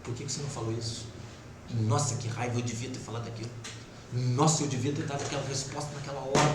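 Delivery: quick, soft mutter to yourself; fast, soft brooding inner voice. Retold or imitated. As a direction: rattle it off under your breath like a nagging thought. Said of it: "a sua mente fica ali".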